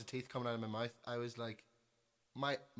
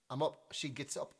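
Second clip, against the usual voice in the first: ordinary delivery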